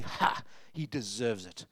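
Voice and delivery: soft, slow, sneering gloat; scornful gloat. Retold or imitated. Crowd mocking the prodigal son, quiet slow scorn. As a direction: savour his downfall under your breath. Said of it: "And they're going".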